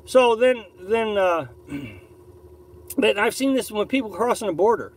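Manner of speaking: normal talking voice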